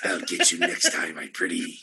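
low quiet Wicked Witch threat; low, quiet hag threat. Retold or imitated. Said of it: "something like that".

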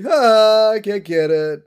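loud, taunting cartoon-dad impression; teasing Homer. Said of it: "Homer teasing a dolphin".

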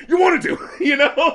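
high, loud imitation of the singer; mock ironic shout. Quoting the singer's joke or irony, mock high shout. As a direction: blurt it high like a hammed-up rock vocal. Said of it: "some sort of joke or irony to him saying".

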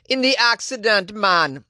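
high, slipped into a put-on Jamaican lilt; reading the quote in a jokey Jamaican voice; lightly hammed Jamaican accent on the quote; mock Jamaican accent. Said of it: "No, my bad".

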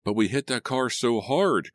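high-pitched, worried protest. A narrator's reading. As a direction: protest with the pitch lifted well up. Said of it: "Carlo protested".

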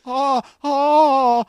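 high, slow squeaky mock moan; hammed-up Ron. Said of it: "And he's like".

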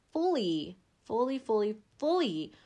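stack the fullys, rising each time; fully, fully, fully, fully growing in force; building emphasis. Acted.

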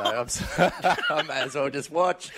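chuckling the words out; jockey laughing at his own joke about his partner, laughing. Real, not acted. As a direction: laugh as you say it.

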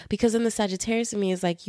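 normal talking voice

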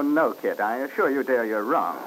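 usual speaking voice

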